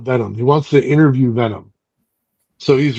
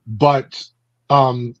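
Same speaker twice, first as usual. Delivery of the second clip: normal talking voice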